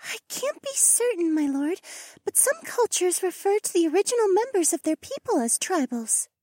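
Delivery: very high, gentle-toned female creature voice; bird companion. Acted. Bird companion explains tribals to her lord, high voice. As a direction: speak high and deferential to your lord.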